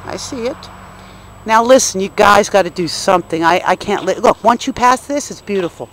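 ordinary talk, nothing marked

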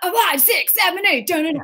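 high, brisk audition count; high-pitched rhythmic count-in; dance count-off. Retold or imitated. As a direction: call out the count like a choreographer. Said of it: "Then they started doing the".

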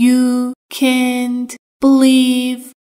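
each word of "You can't believe" drawn out slowly; slow, careful. Shown as a demonstration. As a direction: spell it out slowly for learners. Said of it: "teacher repeats slowly". (آهسته و شمرده)

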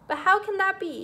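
high, loud disbelief; astonished, voice lifted high; amazed cry. A narrator's reading. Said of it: "he cried, amazed at my calm".

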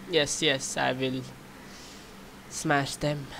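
ordinary talk

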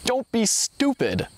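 irritated retort, pitched high and drawn out; storyteller's mild angry snap; narrated growl. A narrator's reading. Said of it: "growled the second man".

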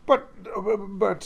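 high, flustered stammer. Acted. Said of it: "stammered Gordon".

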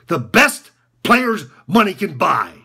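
slow, loud, each word punched; loud scornful repeat. Acted. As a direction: throw it out to the room, word by word. Same words repeated for all to hear.

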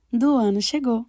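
ordinary delivery, nothing marked

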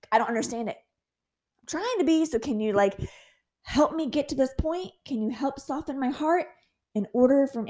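ordinary delivery

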